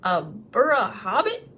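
slow, rough trolls, puzzled; startled trolls. Acted. The trolls startled by Bilbo's answer, slow and rough. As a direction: puzzle over the strange word. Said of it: "a bit startled".

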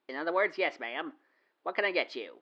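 usual speaking voice

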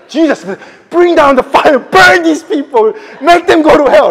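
loud, high, eager pleading; eager disciples. Retold or imitated. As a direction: beg for the fire with glee. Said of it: "I can just see their excitement".

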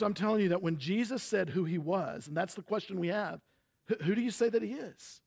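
normal talking voice

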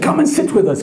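high, welcoming call; welcoming chief. Retold or imitated. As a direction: invite the guest like a chief. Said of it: "the chief said".

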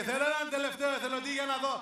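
high, carrying emcee call. Real, not acted. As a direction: appeal to the room for a volunteer. Calls out for last volunteer.

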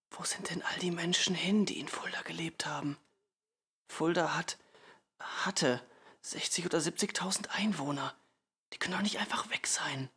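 urgent, high half-whisper; hushed child's bewildered questioning. A narrator's reading. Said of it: "flüsterte ich". (aufgeregtes, helles Flüstern)

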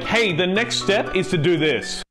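ordinary delivery

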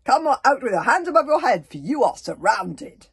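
loud commanding call; loud order. Acted. Grabbit orders whoever is in the cauldron out, loudly. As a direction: order them out like a chief.

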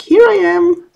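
high, piping voice for a hidden letter; a found letter calling out cheerily; the letter popping out. Pretend. Voicing the letter that finally turns up, high and chirpy.

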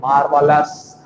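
"मार्वलस" spelt out as maar-va-las; mimicked wrong pronunciation. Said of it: "और तुम बोलते हो". ("मार्वलस" में र पर ज़ोर देकर गलत उच्चारण)

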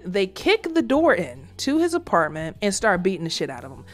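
normal talking voice

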